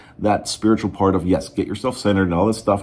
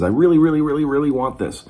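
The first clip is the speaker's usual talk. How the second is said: desperate wanting; 7 semitones higher than usual. Retold or imitated. Coach imitating a desperate ex-chaser, high and pleading. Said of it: "the extreme end of the stick is".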